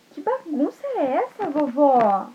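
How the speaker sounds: put-on high, puzzled child voice; surprised little girl. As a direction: ask it wide-eyed and high. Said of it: "ela falou assim".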